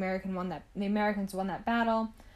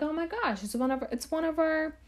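high-pitched excited welcome, imitated; delighted mock gushing; 7 semitones higher than usual. German settlers welcoming Andre, imitated delight in a high pitch. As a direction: gush like townsfolk claiming one of their own. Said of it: "so they loved him".

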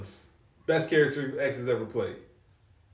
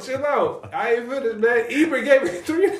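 excited protest; 10 semitones higher, 6 dB louder than usual. Real, not acted. Cutting off friend, laughing.

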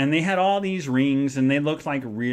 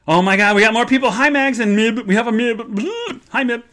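high, louder, fast greeting; excited greeting; 8 semitones higher, 7 dB louder than usual. Real, not acted. Greeting new viewers joining the stream, excited. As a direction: greet the newcomers brightly. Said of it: "greeting chat viewers excitedly".

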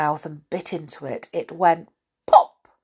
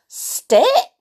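much higher, loud questioning rise; puzzled old man mishears again; mishearing Saucepan Man; 14 semitones higher, 9 dB louder than usual. Acted. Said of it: "said the Saucepan Man".

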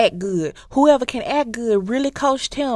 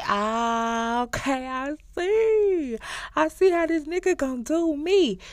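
high, slow I-see-now drawl; knowing sarcasm; 7 semitones higher than usual. Retold or imitated. Brother realizing the setup.

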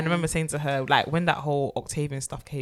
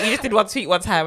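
normal talking voice

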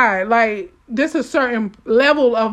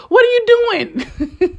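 laughing question; 10 semitones higher, much slower than usual. Pretend. Mocking question to congregation.

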